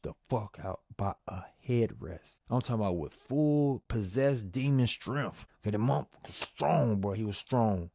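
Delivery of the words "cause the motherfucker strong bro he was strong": ordinary talk, nothing marked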